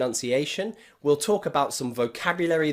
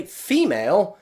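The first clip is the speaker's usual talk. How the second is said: "female" with FE punched higher and louder; stress on first syllable; 4 semitones higher, 4 dB louder than usual. Shown as a demonstration. Teacher showing learners the stressed part of female, first-syllable stress. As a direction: hit the "fee" in "female". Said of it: "the stressed syllable is the first part of that word".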